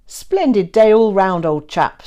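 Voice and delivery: a hint of a bluff, booming old English gent; hearty old colonel. A narrator's reading.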